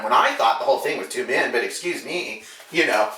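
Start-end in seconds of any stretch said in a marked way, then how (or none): none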